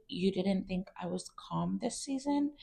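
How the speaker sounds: mock her forced serenity, hushed; fake calm voice. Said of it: "in her, like, calm voice".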